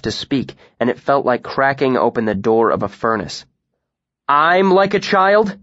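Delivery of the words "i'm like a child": higher, louder, slow spat-out retort; slow and stung, spitting each word. A narrator's reading. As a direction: throw the word back at her. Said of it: "I spat".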